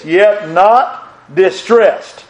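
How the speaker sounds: very slow, stretched yet not distressed; slow preacher emphasis; drawn-out scripture line. Acted. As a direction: lean on each word slowly.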